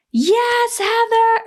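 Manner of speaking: high, bright cheer from the roadside; high-pitched cheering onlooker; cheering spectator. Retold or imitated. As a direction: cheer her on like a fan in the crowd.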